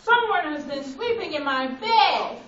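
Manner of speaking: loud, booming papa bear; Papa Bear's big voice reading to the class; great big Papa Bear voice. Acted. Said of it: "Papa Bear said in his great big voice".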